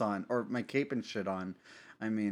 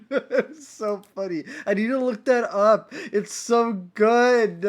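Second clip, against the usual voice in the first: laughing; 8 semitones higher, 8 dB louder, slower than usual. Real, not acted. Cracking up over the video.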